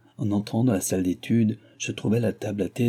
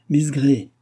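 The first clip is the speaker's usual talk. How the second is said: higher, louder call, half in jest; lightly teasing call by name; half-joking; 6 semitones higher, 5 dB louder than usual. A narrator's reading. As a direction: address her half playfully. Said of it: "dit-il d'un ton à demi plaisant".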